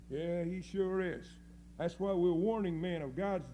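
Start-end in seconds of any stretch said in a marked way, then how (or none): none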